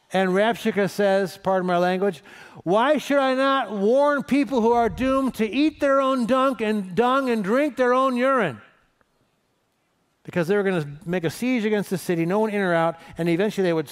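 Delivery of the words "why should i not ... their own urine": loud, high-pitched shout up at the walls; taunting yell. Acted. Assyrian envoy to the people on the city walls, yelling threats. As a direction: yell a drawn-out threat to a whole crowd. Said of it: "he starts yelling out to the people".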